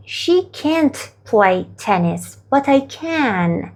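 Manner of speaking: very slow, each word spaced out for learners; slow model sentence. Shown as a demonstration. Teacher modelling the answer very slowly for students. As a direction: spell it out slowly for the class. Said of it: "بگید که اون دختره".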